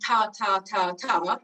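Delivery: "ta" with the t at the start, evenly repeated; consonant first. Shown as a demonstration. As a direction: drill "ta" as separate beats, t leading. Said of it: "noise at the beginning of a sound".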